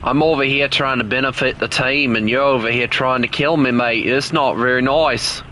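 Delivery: put-on oddly inaccurate Australian accent. Player accusing his teammate of shooting him, mock Australian accent. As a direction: scold in a bad mock Australian. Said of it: "using an Australian accent that oddly be inaccurate".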